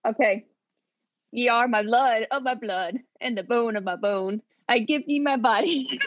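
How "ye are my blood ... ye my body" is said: badly faked Scottish accent; Scottish accent, really badly. Shown as a demonstration. Host reads book quote to guessing contestant, bad Scottish accent. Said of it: "I'm gonna read this, like, really badly".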